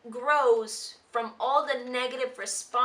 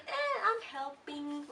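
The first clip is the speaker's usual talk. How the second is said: slow, softer lazy shrug of a line; lazy Wander; 4 semitones higher, 5 dB quieter, slower than usual. Retold or imitated. Wander lounging by the chimney, slow and soft. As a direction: toss it off without bothering. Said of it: "Wander's like".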